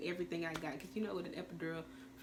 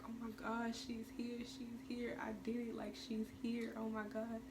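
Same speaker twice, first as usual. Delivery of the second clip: tearful; 5 semitones higher, 5 dB quieter than usual. Retold or imitated. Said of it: "so I'm crying".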